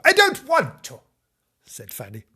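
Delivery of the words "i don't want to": put-on very high, loud childish refusal; shouted, pitched-up tantrum; defiant little girl. A narrator's reading. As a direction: squeal it out like a brat. Fanny refuses again.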